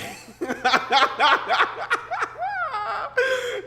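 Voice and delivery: loud, high burst of laughter; big laugh. Real, not acted. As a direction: crack up out loud at the chat. Chat says Harambe, she cracks up.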